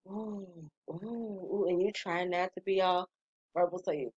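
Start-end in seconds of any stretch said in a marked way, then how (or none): 0.1-1.7 very soft, breathy pleasure moans; massage moans. Retold or imitated. During a massage, soft blissed-out moans. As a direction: hum out little sounds of bliss. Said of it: "you like".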